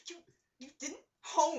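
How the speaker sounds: put-on loud, petulant four-year-old sulk; pouting. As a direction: stretch the last word in a big pout. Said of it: "like, pouting underneath his Britishness".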